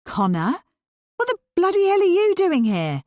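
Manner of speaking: high, loud, startled exclamation; surprised at finding him in her kitchen; high astonished cry; shocked outburst. A narrator's reading. Annie shocked to find Connor.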